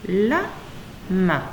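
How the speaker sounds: "La, ma" in two separate syllables. Shown as a demonstration. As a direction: leave a clear gap between "La" and "ma". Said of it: "deux syllabes".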